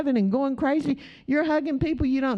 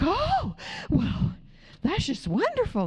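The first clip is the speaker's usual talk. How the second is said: gushing lady; 8 semitones higher than usual. Retold or imitated. Woman's gushing reply retold.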